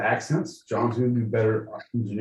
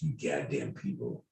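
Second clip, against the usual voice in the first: fake crying; 11 semitones lower, 11 dB quieter than usual. Mock fake-crying patriot.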